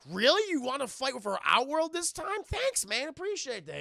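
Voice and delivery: high, loud sarcastic thanks; mock delighted gush. Villain thanking his double-crossing ally, mock delighted. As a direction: gush fake gratitude to the traitor. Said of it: "It's like".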